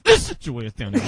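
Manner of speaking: low, harsh whispered scolding; angry whisper. Retold or imitated. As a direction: scold a kid in church under your breath. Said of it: "My mother had the angry whisper".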